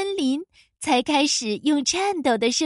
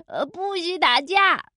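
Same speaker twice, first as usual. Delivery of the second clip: strained, higher cry; calling back; 3 semitones higher than usual. A narrator's reading. Boy running away yells back at wolf, calling out. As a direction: shout back while fleeing. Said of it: "欢仔回头喊道".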